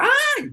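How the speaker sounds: sudden high "¡Ay!" cry; mock startled yelp from the person who was told the news; imitated shriek. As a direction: squeal like someone startled. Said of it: "la persona grita".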